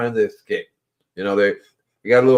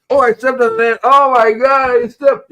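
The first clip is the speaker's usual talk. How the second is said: much higher, louder gasp of guilt; mock dismay; 10 semitones higher, 4 dB louder than usual. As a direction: act horrified at the thing underfoot. Imagined reaction to stepping on life.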